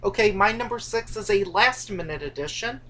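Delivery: ordinary talk, nothing marked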